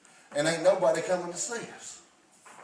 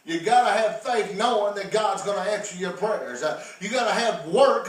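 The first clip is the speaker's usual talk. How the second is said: normal talking voice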